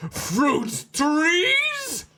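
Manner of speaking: very high, drawn-out incredulous squeal; comic outburst, voice leaping way up; shocked disbelief. Acted. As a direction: shriek the question in mock amazement. Mock shock at the story.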